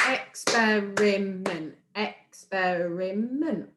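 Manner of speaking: very slow "Experiment", ex-pe-ri-ment in four beats; syllable by syllable. Shown as a demonstration. Teacher working out a big word, "experiment" slowly broken in four. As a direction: draw out every chunk of "experiment". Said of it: "pronouncing the words clearly".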